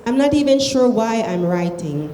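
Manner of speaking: ordinary delivery, nothing marked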